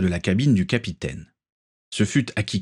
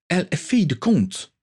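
indignant outcry; 10 semitones higher than usual. A narrator's reading. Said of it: "s'écria Denise".

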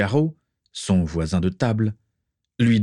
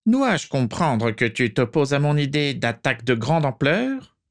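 cold dark lord; 7 semitones higher than usual. Acted. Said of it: "Au vu du sourire".

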